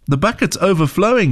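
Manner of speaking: put-on high-pitched excited child; girl's high, alarmed report; exclaiming. A narrator's reading. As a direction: exclaim it as urgent news for mom. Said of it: "she exclaimed".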